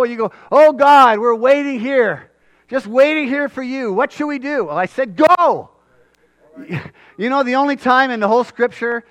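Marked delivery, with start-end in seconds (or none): 0.5-4.6 higher, louder whiny plea to God; mock pleading prayer. Preacher mocking people who wait on God. 5.2-5.7 high, loud one-word order; blunt command. Retold or imitated. God answering the waiting people with a loud order.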